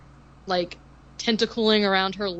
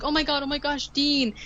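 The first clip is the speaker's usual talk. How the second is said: high-pitched imitation of a heroine calling out; retold excited cry; mock relieved cry; 5 semitones higher than usual. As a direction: call it out high, lightly acted. Acting out Kim finding Dean.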